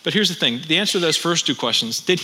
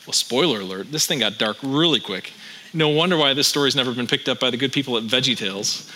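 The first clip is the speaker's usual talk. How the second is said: ordinary talk, nothing marked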